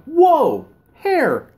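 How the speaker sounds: surprised. Retold or imitated. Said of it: "I'm like".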